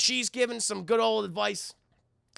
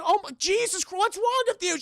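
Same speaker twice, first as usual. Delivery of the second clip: high-pitched appalled outburst; appalled Peter; 10 semitones higher than usual. Retold or imitated. Peter to detective after Felicia runs out, appalled. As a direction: scold in a high, incredulous voice. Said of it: "And Peter's like".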